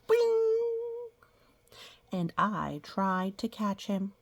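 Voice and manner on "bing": very high, loud bing effect; playful cartoon bing. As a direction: chime it out brightly. Sound effect in story reading.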